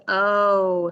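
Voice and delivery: "O" held long and loud; stretched, emphatic long vowel "O"; long O sound. Shown as a demonstration. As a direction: draw out a long "O". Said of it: "what happens to the O".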